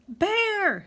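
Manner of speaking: high alarmed shout; a townsperson raises the alarm. A narrator's reading. Someone in town spots the bear and shouts a high warning. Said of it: "somebody shouted".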